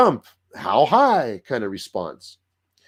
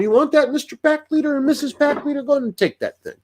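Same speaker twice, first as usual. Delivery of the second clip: high, meek mock voice of a bullied dog; timid dog giving way. Bullied dog to pack leader, high meek yielding voice. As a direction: squeak it out like an underdog handing over its bone. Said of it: "You'll just be like".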